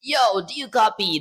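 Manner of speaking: loud, high radio call. Shown as a demonstration. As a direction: bark it like a radio operator. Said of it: "let me just try bringing my radio voice out".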